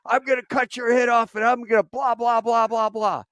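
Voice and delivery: high, loud, raging yell; lieutenant threatening the operator, enraged shout; furious threat. Retold or imitated. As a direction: scream the threat down the radio. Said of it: "He goes".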